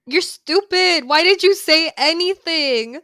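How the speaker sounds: loud, slow exasperated telling-off; mock scolding. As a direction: scold them at full volume, drawing out the words.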